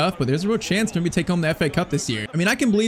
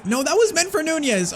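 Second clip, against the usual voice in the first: sharp, much higher cry of frustration at a misplaced pass; frustrated outburst; 11 semitones higher than usual. Real, not acted. Gamer frustrated at his own bad pass, high annoyed cry. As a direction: let the annoyance jump out high. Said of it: "I'm getting so mad right now".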